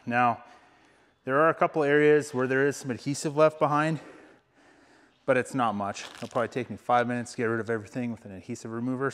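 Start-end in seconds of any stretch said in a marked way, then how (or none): none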